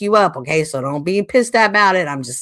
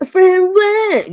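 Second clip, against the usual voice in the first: high, loud slurred drunk imitation; mock drunk slur; 13 semitones higher, 8 dB louder than usual. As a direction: mimic her drunk slurring. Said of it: "you can hear her slurring".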